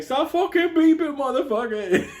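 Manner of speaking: high-pitched mock panicked plea; nervous protest. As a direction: imitate a rattled cellmate begging for it to stop. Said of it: "Beatty's all fucking nervous".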